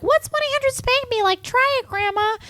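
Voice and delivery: put-on high-pitched little boy voice; little grandson. Little grandson urging his grandmother to dial a number, high childish voice. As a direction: pipe up high like a kid egging Grandma on. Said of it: "her little grandson was like".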